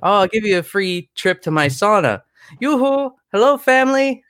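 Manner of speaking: lilting Norwegian impression, pitched well up; Oaken. Shopkeeper offers a sauna trip and greets his family, high singsong Norwegian. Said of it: "very stereotypical Norwegian sounding dude".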